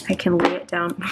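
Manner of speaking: usual speaking voice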